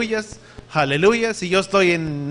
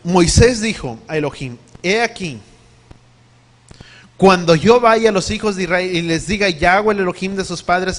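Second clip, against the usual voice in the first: louder, forceful reading of the verse; scripture reading; 6 dB louder than usual. Acted. Preacher reading Exodus to the congregation, forceful and loud. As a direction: proclaim the scripture to the church.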